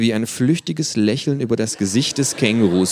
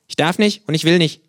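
mouth full; 10 semitones higher, 7 dB louder than usual. Acted. Kangaroo character voice.

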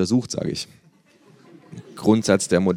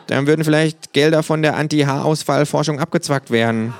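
higher, insistent character voice; opinionated kangaroo; 7 semitones higher, 3 dB louder than usual. Acted. Sick kangaroo lecturing flatmate on finding cures, opinionated voice. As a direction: argue it like a serious proposal.

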